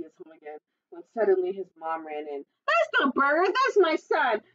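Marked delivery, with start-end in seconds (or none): 2.7-4.4 high, indignant protective yell; outraged mom, high-pitched; mother's shout. A narrator's reading. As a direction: shout in defense of your child. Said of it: "she shouted".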